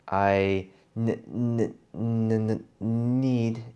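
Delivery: stuttering on the first sound. Shown as a demonstration. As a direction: repeat the n sound before need. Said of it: "So you might say".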